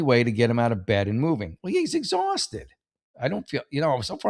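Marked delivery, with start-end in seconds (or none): 1.6-2.7 mock sympathy. Sarcastic echo of earlier "he's tired.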